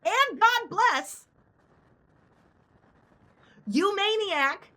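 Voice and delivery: replaying the absurd parting line, slow and high; slow mock quote. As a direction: drag out each word in disbelief. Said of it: "Said it four times just now".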